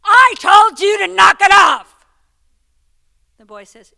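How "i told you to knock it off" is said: slow, much higher, full-volume bellow; father shouting at the kids for the last time; furious shout. Retold or imitated. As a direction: roar it like a dad at the end of his rope. Dad's final furious shout.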